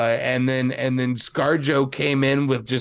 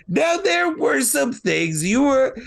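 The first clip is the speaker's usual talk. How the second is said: high, slow imitation of a co-host's protest; mock objection; 10 semitones higher, slower than usual. Podcaster mimicking co-host defending a film, mock protest. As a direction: draw it out as a pitched-up mock objection.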